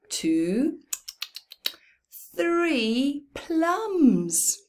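ordinary delivery, nothing marked

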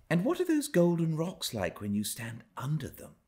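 put-on a dreamy child gazing at far hills; young Cathy wondering. A narrator's reading. Cathy asks Ellen from the nursery window, high wondering voice. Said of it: "she once asked".

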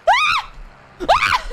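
short high, loud shriek; sharp high yelp of alarm; startled shriek. Real, not acted. Gamer under attack, short loud shrieks.

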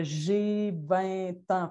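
"J'ai vingt ans" repeated very slowly and clearly. Shown as a demonstration. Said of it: "je répétais beaucoup, beaucoup". (« J'ai vingt ans » répété très lentement)

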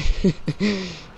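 real, high giggle; can't help laughing at the memory; amused laugh. Real, not acted. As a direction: burst into a short giggle. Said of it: "I'm still laughing right now".

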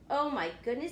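ordinary delivery, nothing marked

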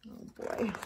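quick hushed aside; rushed whispered aside; fast whisper. Real, not acted.

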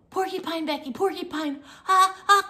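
high, loud frightened cry; scared cry. Retold or imitated. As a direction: yelp in alarm at the porcupine. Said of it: "I was scared".

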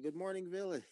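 high, singled-out morning greeting; wake-up call. Pretend. Moderator wakes the players.

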